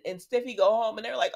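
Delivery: normal talking voice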